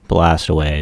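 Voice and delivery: ordinary delivery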